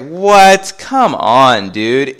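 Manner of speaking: loud, high "what" then exasperated; appalled disbelief. Retold or imitated. Son rejects mom's outrageous theory, appalled. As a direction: balk at an absurd claim. Son reacts to absurd accusation.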